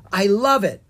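loud, high delight. Acted. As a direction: relish the stink. Delight in stink.

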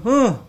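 short, high huff; imitated huff. Shopkeeper imitating customer's huff at the price, huffing. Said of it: "Huffing. That's the noise of the huff".

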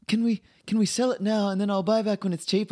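high, pleading mock mum voice; imitating his mum. Son mimics his mum begging to sell Bitcoin, high mock plea. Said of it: "she's like".

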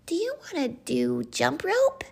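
high-pitched little playmate voice; high cartoon pal; Scout. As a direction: ask like a hopeful small friend. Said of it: "asked Scout".

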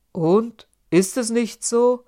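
loud, rising, astonished question. A narrator's reading. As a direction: sound genuinely taken aback. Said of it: "fragte Michel staunend".

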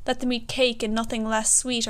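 normal talking voice

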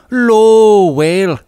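hammed-up, wailing Irish narration; drawn-out dramatic emphasis. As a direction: draw out the vowel like the wail itself. Dramatic reading of a ghostly wail.

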